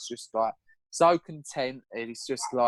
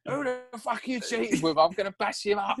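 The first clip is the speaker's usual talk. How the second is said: high-pitched mock indignation; retold jealous outburst; 9 semitones higher than usual. Boyfriend accusing his crying girlfriend, hammed-up jealous threat.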